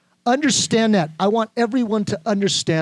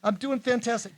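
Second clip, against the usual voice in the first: demo cheerful answer; 5 semitones higher, 7 dB quieter than usual. Shown as a demonstration. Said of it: "Say it".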